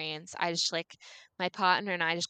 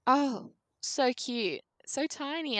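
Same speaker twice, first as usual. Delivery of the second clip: slow, higher, tender coo over a tiny newborn; cooing at baby; 6 semitones higher, slower than usual. Retold or imitated. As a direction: melt over the baby, drawing each word out. Mother first sees newborn.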